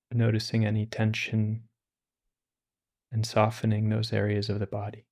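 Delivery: calm, unhurried meditation voice with long pauses; guided meditation. Acted. Teacher guiding listeners through meditation, calm and unhurried. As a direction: ease listeners into stillness.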